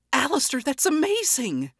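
high, bright excited cry. A narrator's reading. Charlie thanking Alastor for the poster, excited. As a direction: burst with relief. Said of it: "Charlie shouted in excitement".

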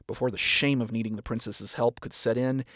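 ordinary delivery, nothing marked

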